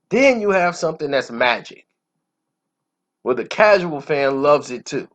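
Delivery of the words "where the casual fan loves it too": usual speaking voice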